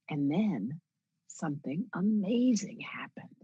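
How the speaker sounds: slow storyteller read with AMAZING drawn out; pause, then punch amazing as if telling children a story; children's storytelling. Retold or imitated. Reporter trying the line as a children's story, slow and emphatic. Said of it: "I'm reading a children's story to my kids".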